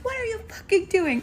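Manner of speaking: imitated irate driver, very high-pitched; mock screaming. Bus driver screaming at a pedestrian, high mock yell. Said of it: "he starts screaming".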